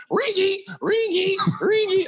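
very high-pitched squeaky noise, three times; imitated squeak. Joking with a friend about squeezing into the lunchroom table, imitated squeak.

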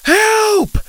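very high scream; desperate scream. A narrator's reading. Second cry for help.

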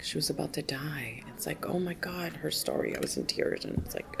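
whispered, voice dropping away; hushed whisper. Real, not acted. To the interviewer about a newcomer's story, hushed whisper. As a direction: whisper it like it still chokes you up. Retelling a newcomer's moving share.

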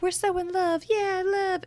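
mock the besotted couple; high, syrupy parody of lovers; mocking lovey-dovey. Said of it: "having a moment where they're like".

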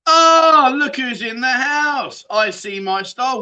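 high, slow sing-song welcome, stretched out; excited greeting. Real, not acted. Host greets a favourite viewer joining the stream, high and sing-song. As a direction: croon a big happy hello to a favourite viewer.

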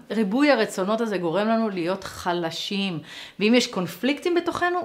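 ordinary talk, nothing marked

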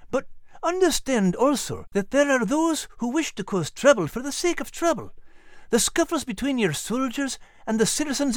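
put-on high, earnest old Scottish sergeant; warning. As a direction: urge caution in a lilting Scottish voice. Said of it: "Sergeant Frost warned".

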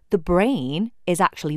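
usual speaking voice

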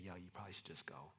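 very soft, low, quick murmur; voice in your head. Acted. Inner voice warning you about company, hushed murmur. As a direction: slip it in under your breath. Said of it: "a voice in your head saying".

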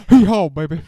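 put-on a loud hee haw that drops into an [name] baby; mock [name] donkey. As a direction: bray it, then croon like [name]. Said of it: "heeing and hawing".

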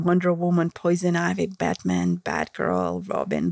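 ordinary talk, nothing marked

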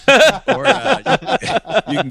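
laughing high and loud. Real, not acted. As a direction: burst out laughing mid-word. Laughing while answering.

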